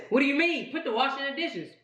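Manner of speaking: mock baffled, pitch jumping up; incredulous question. Mocking partner's mixed-up words.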